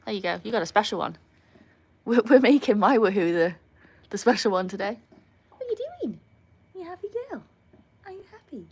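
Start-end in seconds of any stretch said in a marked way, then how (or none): 5.5-8.7 very high, soft dog-directed voice; pet-talk. Real, not acted. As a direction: coo questions to your dog, high and quiet. Cooing questions to her dog.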